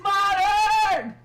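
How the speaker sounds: loud excited shout. Real, not acted. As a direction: shout it out happily. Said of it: "Sorry, I'm being loud".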